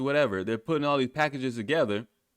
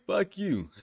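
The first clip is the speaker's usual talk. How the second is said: higher-pitched mock brush-off, amused; mock dismissive government; 7 semitones higher than usual. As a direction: voice a dismissive government through laughter. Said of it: "A government saying".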